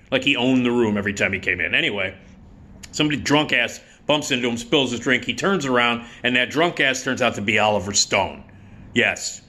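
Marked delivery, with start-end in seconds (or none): none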